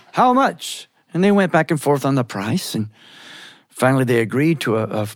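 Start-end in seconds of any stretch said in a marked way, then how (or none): 0.1-0.9 insistent, high New York Yiddish demand; impatient New York Yiddish haggler; New York Yiddish accent. Acted. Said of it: "that New York Yiddish accent".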